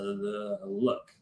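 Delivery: soft, careful plea into the dark room; a quiet, uneasy hello to a spirit; hushed appeal to a ghost. Retold or imitated. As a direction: speak low, as if someone unseen is listening. Speaking softly to a spirit in the dark.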